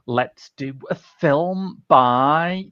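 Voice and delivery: slow drawn-out radio host delivery; Jeremy Vine. Pretend. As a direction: stretch out the last words. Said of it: "stretching out the last words of every sentence".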